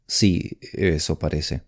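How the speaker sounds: low, subdued grudging agreement; grumbled. A narrator's reading. Young man giving in to the woman, grumbling. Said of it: "gruñó él".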